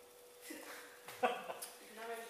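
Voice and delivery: high, breathy nervous chuckle; brief embarrassed laugh; awkward laugh. Real, not acted. Nervous laugh before speaking.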